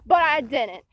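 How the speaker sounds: ordinary talk, nothing marked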